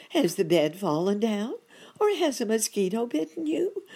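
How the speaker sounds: high, loud worried lady voice; a flustered housekeeper checking on him; fussing muskrat lady. A narrator's reading.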